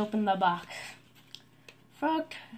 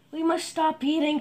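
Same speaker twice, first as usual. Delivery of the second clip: higher, louder cry of alarm; 7 semitones higher, 7 dB louder than usual. A narrator's reading. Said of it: "cried Toad".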